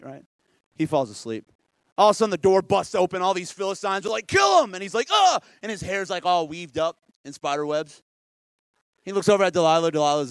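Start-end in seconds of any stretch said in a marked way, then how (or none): 4.3-4.7 high, loud battle yell; attack cry. Retold or imitated. Philistines burst in to attack. 5.1-5.4 high, loud startled "ah!"; startled yell. Retold or imitated. Samson startled awake.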